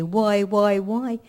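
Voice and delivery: mock wail of protest; echoed cry of why. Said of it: "Did you say, 'Why, why".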